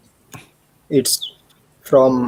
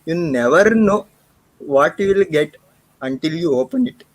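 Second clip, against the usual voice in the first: put-on a playful Indian accent on a movie quote; 4 semitones higher than usual. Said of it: "I should try it in the Indian accent".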